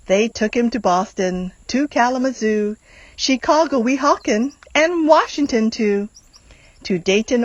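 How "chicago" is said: ordinary delivery, nothing marked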